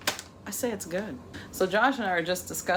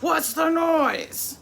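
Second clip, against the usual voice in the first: slow, high, loud stern mom; 10 semitones higher, 5 dB louder, much slower than usual. Retold or imitated. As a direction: drag it out like a mother demanding answers. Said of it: "I had to put on my mom voice".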